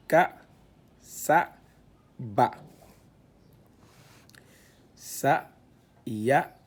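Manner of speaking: syllabes détachées, plus fortes; lecture syllabée. Shown as a demonstration. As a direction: scande chaque syllabe. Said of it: "on lit le la avec l'outil d'allongement". (« Wa, ma, ka, sa, ba » récité syllabe par syllabe)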